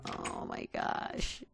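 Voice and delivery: very low, slow, quiet groaned words; deep groan. Real, not acted. Gamer overwhelmed by cows, slow deep groan.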